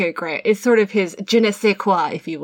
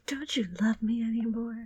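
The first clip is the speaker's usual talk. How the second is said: breathy unvoiced whisper of the line; dreamy whispered echo; 13 dB quieter than usual. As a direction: breathe it out like a swoon.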